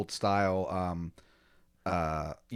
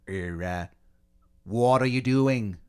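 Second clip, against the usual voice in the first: put-on awful Boston accent; 4 dB louder than usual. Colonist confronting the reckless newcomer, awful Boston accent. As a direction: challenge them in terrible fake Bostonese. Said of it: "easily the worst Boston accents".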